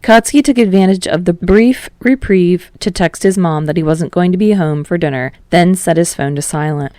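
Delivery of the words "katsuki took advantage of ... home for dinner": plain reading voice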